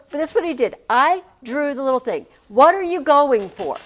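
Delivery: usual speaking voice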